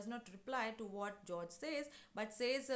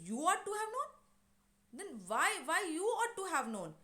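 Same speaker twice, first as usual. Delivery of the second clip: pitched up, mildly heated retort; retold angry retort; 7 semitones higher, 5 dB louder than usual. Husband turning on the nosy neighbor, mildly heated and pitched up. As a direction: let the husband's irritation push the voice higher. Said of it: "he is angry".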